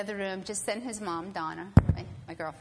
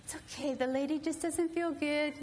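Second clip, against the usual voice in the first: put-on child's reassurance; 7 semitones higher than usual. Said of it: "I hear them saying".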